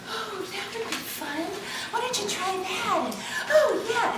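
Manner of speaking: high, hushed coaxing; a high, soft inviting muse; playful muse. Retold or imitated. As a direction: tempt them gently. The muse voiced.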